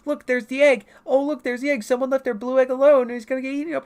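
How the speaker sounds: high, loud, frantic exclaiming; hammed-up high-pitched urgency; mock alarm. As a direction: act out spotting an egg in danger. Acting out spotting egg in danger.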